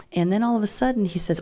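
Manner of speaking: normal talking voice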